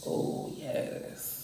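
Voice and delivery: breathy whispered delight; whispering. Real, not acted. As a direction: whisper it with pleasure. Whispered reaction to food.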